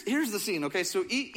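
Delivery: ordinary talk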